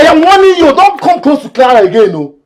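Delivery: high, full-voiced shout; furious warning.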